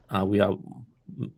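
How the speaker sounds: ordinary talk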